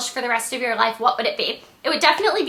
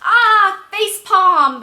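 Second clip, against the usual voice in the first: high, loud groan of exasperation; mock British viewer face palm; exasperated UK viewer; 7 semitones higher, 5 dB louder, much slower than usual. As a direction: throw up your hands at a bad accent. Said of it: "you're probably like".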